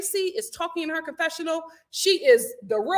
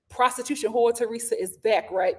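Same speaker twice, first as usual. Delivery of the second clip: usual speaking voice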